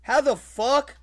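ordinary talk, nothing marked

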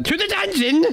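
high, commanding call; mock royal command. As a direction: order them off like a tyrant. Sentencing the king.

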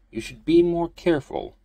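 put-on high, far-off old priest voice. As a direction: gently chide like an old priest. Priest voice, scolding gently.

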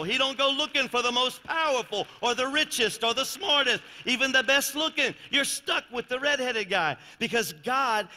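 loud, high, driving preacher's cry; full-force preaching. Acted. Pastor telling the congregation God picks the unlikely, roaring sermon. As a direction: build the room up to a roar.

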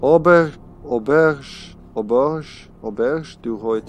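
reading a sign in a French accent, trailing off; casual French accent on auberge du Roi. Real, not acted. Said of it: "reading with the French accent".

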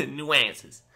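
laughing nuances in a hammy New York voice; bad New York accent. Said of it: "sounds like I'm trying to do a really bad New York accent".

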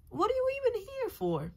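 squeaky toy voice. Pretend. Toy play, squeaky character voice.

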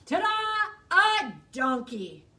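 loud, high ta-da; booming showman reveal; grand reveal. Acted. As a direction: present it like a magic trick.

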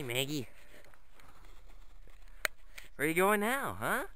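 ordinary talk, nothing marked

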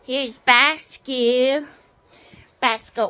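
ordinary talk, nothing marked

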